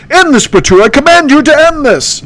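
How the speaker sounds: squeaky command; Candace voice. Acted. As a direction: hero ordering the ghost king, high. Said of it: "that's my impression".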